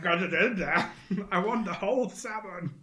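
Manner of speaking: ordinary talk, nothing marked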